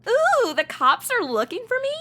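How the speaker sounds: high, thrilled squeal at being hunted; delighted to be a fugitive; excited squeal. Acted. Madison thrilled that the police are hunting her.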